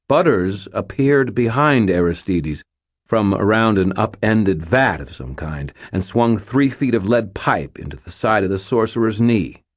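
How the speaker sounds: ordinary delivery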